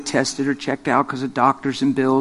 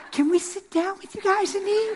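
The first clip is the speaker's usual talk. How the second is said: put-on much higher, eager young girls' voice; girls asking; 5 dB quieter than usual. Subway girls asking to join the preachers, eager high voice. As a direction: ask like a keen teenage girl. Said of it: "They come right around".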